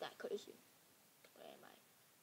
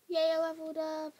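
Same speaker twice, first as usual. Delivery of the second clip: loud, higher-pitched little cheer; excited; 6 semitones higher, 10 dB louder than usual. Real, not acted. Celebrates levelling up in game.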